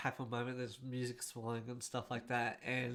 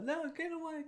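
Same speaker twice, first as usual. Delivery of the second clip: mock Chewie pushing away. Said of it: "he's like".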